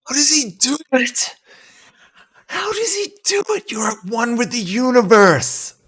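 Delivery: very high-pitched, hammed-up put-on accent; squeaky, over-the-top bad impression of an awestruck onlooker; bad accent impersonation. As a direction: exclaim in amazement in a badly faked high voice. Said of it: "Sorry for the bad accent impersonation".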